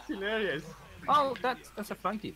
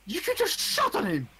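high, raised-voice outburst; heated swearing; 8 semitones higher, 4 dB louder than usual. Real, not acted. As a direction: curse them out in exasperation. Swearing at another player in game.

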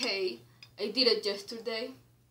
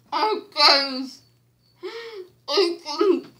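laughing with mouth full; 7 semitones higher, 7 dB louder, much slower than usual. Real, not acted. Mouth stuffed, bursting into laughter.

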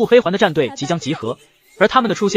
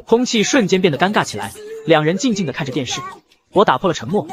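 ordinary delivery

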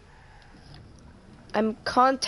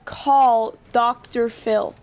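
slow voice command; 2 semitones higher, 3 dB louder, much slower than usual. Pretend. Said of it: "Hey Siri".

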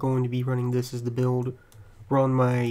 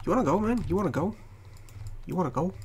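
higher cocky taunt, mock challenge; fake tough guy swagger; 5 semitones higher, 3 dB quieter, faster than usual. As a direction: goad him like a playground bully. Taunting the enemy player.

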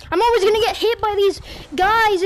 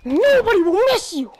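villain voice; 3 semitones higher than usual. Shown as a demonstration. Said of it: "repeats line, higher, far voice".

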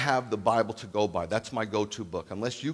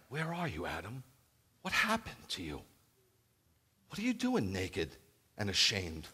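tender divine call; 6 semitones higher than usual. Acted. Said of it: "God calling Adam tenderly".

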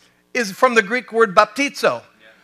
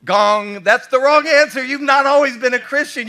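raised, louder playful correction; mock rejection; 5 semitones higher, 7 dB louder than usual. Preacher buzzing out a small-group member's claim, loud mock rejection. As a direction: call out a wrong answer like a joking judge. Game-show style rejection, mocking.

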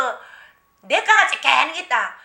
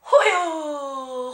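long drawn-out sob; sobbing cry; much slower than usual. Retold or imitated. Shaman's first words break out as a drawn sob. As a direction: let one long sob out. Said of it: "울면서 말문이 터졌어".

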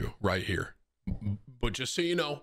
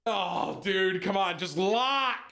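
high, pitched-up exasperation at a broken chair; fighting the chair and losing patience; real frustration; 11 semitones higher than usual. Real, not acted. Streamer struggling with his broken chair, real high exasperation.

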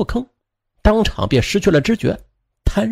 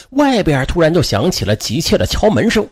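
ordinary delivery, nothing marked

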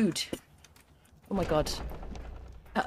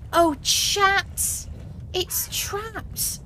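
much higher, squealing that it's trapped; excited disbelief; 11 semitones higher, much slower than usual. Real, not acted. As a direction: shriek it out in disbelief. Finds creature trapped.

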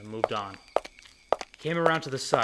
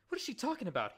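higher, anxious man's voice; Levine voice; 12 semitones higher, 6 dB quieter, faster than usual. A narrator's reading. Panicky Levine asking Thorn about her, higher voice. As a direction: voice a nervous Levine, pitched up.

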